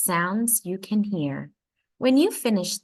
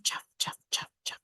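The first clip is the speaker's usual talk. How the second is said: whispered, softer chuff, chuff like footsteps; 8 dB quieter, faster than usual. A narrator's reading. Reading to a child, whispering father's soft shoe steps. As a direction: breathe the footsteps out softly. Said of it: "They make a soft sound".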